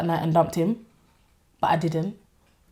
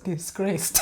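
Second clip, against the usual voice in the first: ordinary delivery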